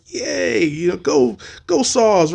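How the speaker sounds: high bouncy cheerleader yells; mock cheer. Scare actor mimicking go-go cheerleaders, cheering. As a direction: cheer them on like a pep squad.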